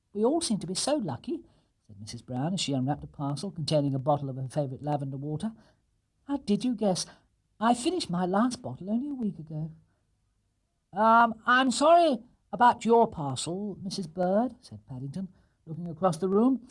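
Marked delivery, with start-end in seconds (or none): 0.1-1.4 delight in the gifts; higher, gentle mum; pleased mother. Acted. Mrs Brown voiced. 6.2-9.7 higher, gentle delighted mum; pleased mother. Acted. Mrs Brown voiced. 10.9-14.6 higher, slow, louder apologetic bear; higher, slow bear apology; sorry bear. Acted. Paddington apologises.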